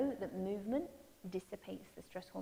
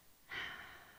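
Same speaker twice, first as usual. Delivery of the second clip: long sigh; 6 dB quieter than usual. Real, not acted. Deep breath in meditation.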